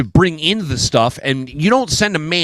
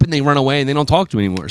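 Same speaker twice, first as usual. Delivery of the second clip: normal talking voice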